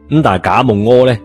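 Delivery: ordinary delivery, nothing marked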